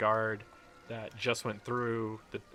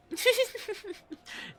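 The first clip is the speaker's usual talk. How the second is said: put-on very high girlish giggle; 3 dB louder than usual.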